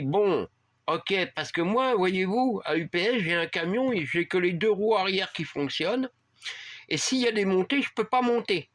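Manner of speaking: hint of a Spanish-accented driver, rising at the end. Driver explaining his truck to the customer, light Spanish accent. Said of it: "avec un fort accent espagnol".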